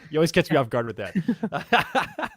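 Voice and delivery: slow, helpless laugh through the words; real laughing. Real, not acted. Host to co-host after being caught off guard, laughing.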